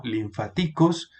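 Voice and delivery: "linfáticos" stressed on the third syllable, lin-fa-TÍ-cos; wrong stress on TI. Shown as a demonstration. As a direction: move the stress of "linfáticos" onto TI. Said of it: "ni tampoco". («linfáticos» mal acentuado en TI: linfaTÍcos)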